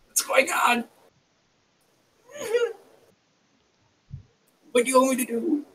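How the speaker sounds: high-pitched, bewildered alarm; scared and helpless, high voice; acted panic. Character panics at voice in head.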